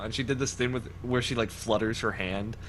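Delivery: ordinary talk, nothing marked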